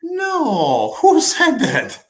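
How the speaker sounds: high, drawn-out mock indignation; mock outrage. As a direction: play shocked and protective of your co-host. Playful outrage on co-host's behalf.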